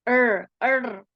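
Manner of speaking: "R" as a held curled rr; r sound. Shown as a demonstration. Teacher showing students the r sound, held rr. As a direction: draw out "R" with the tongue curled. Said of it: "and then this is".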